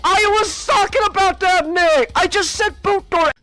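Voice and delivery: high and pleading, almost crying; exasperated protest. Real, not acted. Gamer defending himself to Nick, exasperated and whiny. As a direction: insist you were right all along. Arguing with Nick over directions.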